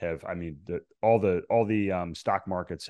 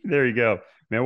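excited; 6 semitones higher, 9 dB louder than usual. Real, not acted. Host cheers guest's answer.

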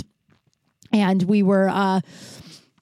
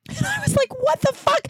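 high, laughing what the fuck; shocked squeal of disbelief. Retold or imitated. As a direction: laugh then blurt it out, pitched way up. Retelling the dad's shocking answer.

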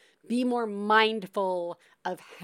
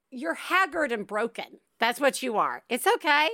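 ordinary delivery

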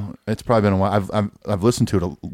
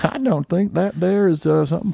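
hillbilly voice; 9 semitones higher than usual. Pretend. Hillbilly voice bit.

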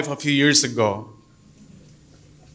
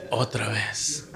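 "Otra vez" dropped low and much softer; soft repeat; 7 semitones lower, 11 dB quieter, slower than usual. Real, not acted. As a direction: murmur "Otra vez" to himself, resigned. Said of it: "I have to, otra vez".

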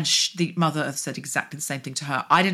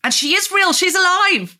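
much higher and louder, insisting; emphatic cry; 9 dB louder than usual. Real, not acted. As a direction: burst out in earnest conviction. Insisting Mother Earth is alive.

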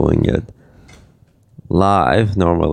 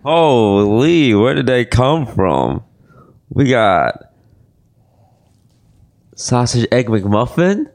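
excited; 5 semitones higher than usual. Acted. Staged surprise at McDonald's food.

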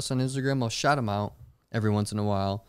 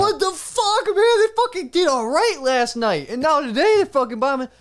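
high, loud exasperated outburst; frustrated yell; 9 dB louder than usual. Retold or imitated. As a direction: yell it in disbelief. Retold frustration after jokes bombed.

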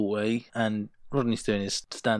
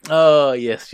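loud, raised "oh" of remembering; sudden recognition; 5 semitones higher, 12 dB louder than usual. Real, not acted. Co-host recalls the famous sandwich scene, delighted recognition. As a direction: exclaim as it comes back to you.

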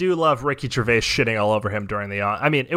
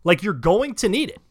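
exasperated plea. Retold or imitated. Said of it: "I'm just like".

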